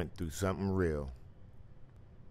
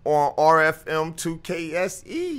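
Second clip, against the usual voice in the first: hyped announcer; 9 semitones higher, 13 dB louder than usual. Acted. Host hyping first caller.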